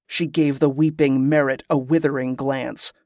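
plain reading voice

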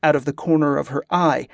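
ordinary delivery

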